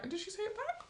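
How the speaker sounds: high mocking producer. Messy producer to groom, high and teasing. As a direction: squeak the question like a stirring producer. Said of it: "producer's like".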